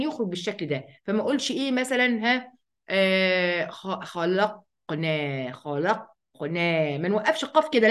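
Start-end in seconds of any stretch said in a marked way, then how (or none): 3.7-7.0 خلقنا slowed, the qaf bouncing out; slow demo of خلقنا. Shown as a demonstration. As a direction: demonstrate خلقنا slowly for students. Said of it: "بنهز اللسان في مكان نطق الحرف".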